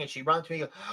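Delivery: usual speaking voice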